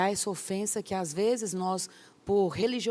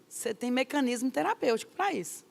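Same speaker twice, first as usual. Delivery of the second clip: usual speaking voice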